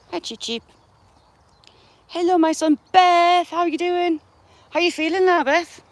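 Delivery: high, sing-song voice to a pet; pet talk. Real, not acted. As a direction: coo at the chickens like babies. Talking sweetly to pet chickens.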